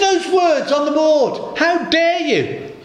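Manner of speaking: high, slow indignant posh voice; outraged posh lady. Retold or imitated. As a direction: imitate an appalled lady scolding a stranger. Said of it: "Very posh lady".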